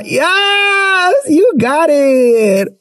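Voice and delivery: yes shrieked very high, then drawn out slowly; excited shout. Real, not acted. Host cheering a listener who called them number one, high excited shout. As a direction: cheer the compliment like a win.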